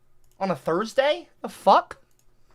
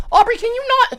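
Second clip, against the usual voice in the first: exasperated squeak; 6 dB louder than usual. Real, not acted. Exasperated at wife.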